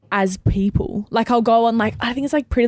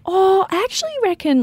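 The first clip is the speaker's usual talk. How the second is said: much higher, louder tentative suggestion; high, hedging voice for a gentle suggestion; voice goes up; 12 semitones higher, 4 dB louder than usual. Shown as a demonstration. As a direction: lift the voice way up to soften it. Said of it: "my voice goes up".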